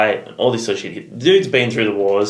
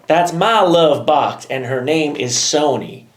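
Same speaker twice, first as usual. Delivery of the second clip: slow, higher hillbilly Cletus voice; playful Cletus impression, slow and high; 5 semitones higher than usual. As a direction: drawl it slowly like a proud yokel. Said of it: "You know Cletus?".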